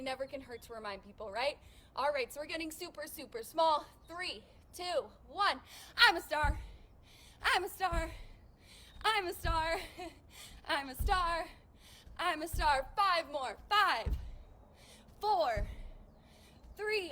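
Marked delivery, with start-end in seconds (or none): 6.0-12.9 shouting while jumping. Shown as a demonstration. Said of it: "if you scream".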